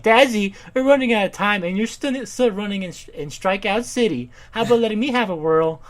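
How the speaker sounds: put-on high, emphatic sixties-girl caricature; put-on rich daughter. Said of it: "daughter Sam is like".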